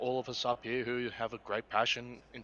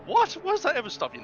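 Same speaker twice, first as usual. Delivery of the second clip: mock outrage; 9 dB louder than usual. Real, not acted. Teasing banter with co-host.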